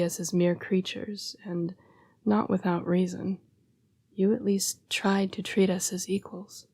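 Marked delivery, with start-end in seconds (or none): none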